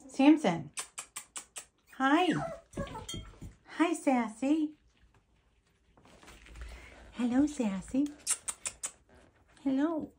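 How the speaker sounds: bright excited puppy voice; pet talk. Real, not acted. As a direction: coo up high at the little dogs. Cooing at the puppies.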